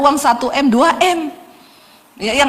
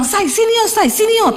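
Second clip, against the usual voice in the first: calling out; 10 semitones higher than usual. Retold or imitated. Husband calling wife urgently.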